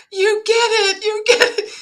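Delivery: very high, squealing excitement; retold overjoyed cry. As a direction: squeal it, thrilled to be understood. Retold joy at being understood.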